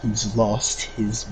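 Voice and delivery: slow, low and soft; Monsoon. Acted. Gamer quoting a boss on Raiden's madness, slow low menace. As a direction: draw each word out low. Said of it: "Monsoon's like".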